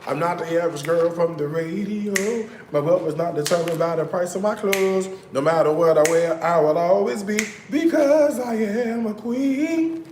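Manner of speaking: mock diva anthem; quoting a sassy song. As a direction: belt it like a diva ending on a sung "queen". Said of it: "What she said?".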